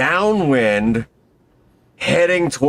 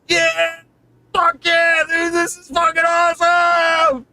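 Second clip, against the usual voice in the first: much higher, shouting with joy; excited whoop. Retold or imitated. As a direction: whoop it like riding a wingsuit. Thrilled riding on his back.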